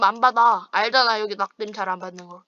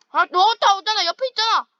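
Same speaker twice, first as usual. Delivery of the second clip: much higher, louder panicked call; urgent alarm; 10 semitones higher, 6 dB louder than usual. Real, not acted. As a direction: warn him fast, he's being chased. Warning teammate being chased. (다급하고 놀란 외침)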